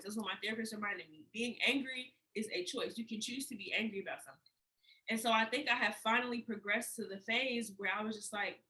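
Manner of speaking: soft, low and heavy, holding back tears; quietly fighting not to cry; deep sadness. Real, not acted. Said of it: "doing my best to not cry".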